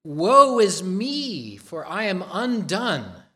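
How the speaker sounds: loud, slow anguished cry; trembling cry. Retold or imitated. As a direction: cry out as a man undone. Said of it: "And Isaiah trembled too. He said".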